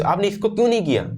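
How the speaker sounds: mock scolding question; upset, accusing; mild angry demand. Shown as a demonstration. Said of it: "angry tone".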